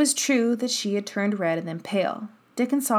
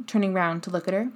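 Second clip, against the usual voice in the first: usual speaking voice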